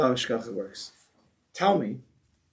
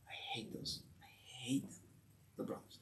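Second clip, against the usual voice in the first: whispered grudge, low and unvoiced; resentful whisper; 4 semitones lower, 23 dB quieter than usual. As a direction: hiss it like a secret grudge. Imagined resentful thoughts of Yosef.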